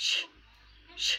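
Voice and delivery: ช as a bare hushing sh; soft sh sound. Shown as a demonstration. Teacher showing the second of two sounds, ช as soft sh. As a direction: say ช as a soft hiss, no vowel. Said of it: "สามารถออกเสียงได้สองเสียง".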